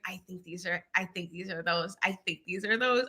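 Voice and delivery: soft, low hushed inner voice; hope it quietly to yourself; inner hopeful voice. Retold or imitated. Wife hushed, hoping in her heart she is pregnant. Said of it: "in my heart, I was like".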